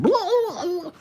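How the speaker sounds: mock yelling in a very high squeak; squeaky yelling guy. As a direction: squeal the gibberish like the angry driver. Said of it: "it was literally like that verbatim".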